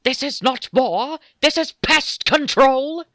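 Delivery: high, loud, drawn-out Dalek shriek; Dalek impression. Podcaster quoting a favourite Doomsday line as a shrieking Dalek. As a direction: screech it slowly like an outraged Dalek. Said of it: "in Doomsday where it's like".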